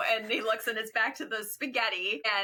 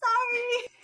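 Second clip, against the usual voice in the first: much higher, sing-song apology; mock chirpy Jackie. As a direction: toss a breezy sorry while driving off. Jackie leaving in her car, retold.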